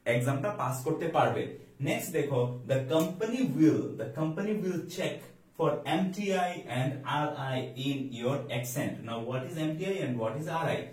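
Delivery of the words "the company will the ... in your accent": slow dictation. Shown as a demonstration. Teacher reads example sentence slowly.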